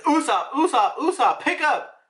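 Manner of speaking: high, insistent retold calling; mock urgent call. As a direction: act out a frantic phone plea. Acted urgent emergency call.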